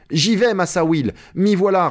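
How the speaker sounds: high, eager answer; brisk reply. A narrator's reading. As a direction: answer readily, pitched well up. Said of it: "répliqua lestement le nègre". (réponse leste et aiguë)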